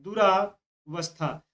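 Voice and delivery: दुरावस्था stretched out very slowly, each syllable apart; teacher dictating दुरावस्था slowly to students; slow spelled-out demo. Shown as a demonstration. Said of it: "ऐसे लिखता है".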